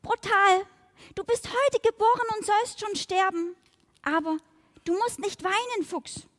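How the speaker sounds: high, earnest little fly voice; pitying the fox she thinks is dying; sympathetic young fly. Acted. Fly comforting the fox, high and earnest.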